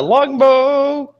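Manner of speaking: loud, high weapon call; big high-pitched flourish; triumphant announcement. Pretend. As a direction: sing out the weapon name, drawn out high. Announces weapon for attack roll.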